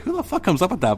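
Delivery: high-pitched, amazed reaction; retold incredulity. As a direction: pitch shooting up in mock disbelief.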